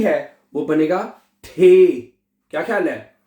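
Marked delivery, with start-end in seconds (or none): 1.4-1.9 ठे said with a strong breathy aspirated ठ; aspirated the sound. Shown as a demonstration. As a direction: push air out on ठे like ठेला. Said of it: "ठे की आवाज निकाली आपने". (ठे, ठेला जैसी आवाज़ में)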